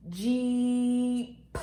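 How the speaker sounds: JEEP drawn out long, final P released on its own; long held vowel in Jeep, then a separate P; stretched-out word. Shown as a demonstration. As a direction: model Jeep slowly, sound by sound. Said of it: "do that with me".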